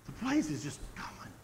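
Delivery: hushed whisper. Real, not acted. Preacher hushes describing a racing blaze, whisper. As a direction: breathe it out like awe at the fire.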